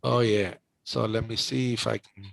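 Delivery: ordinary talk, nothing marked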